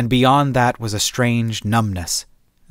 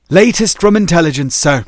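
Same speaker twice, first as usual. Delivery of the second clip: crisp soldier; 6 semitones higher, 6 dB louder than usual. Acted. Soldier reports to captain.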